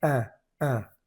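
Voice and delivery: a said as a nasal vowel. Shown as a demonstration. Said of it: "que se pronuncia".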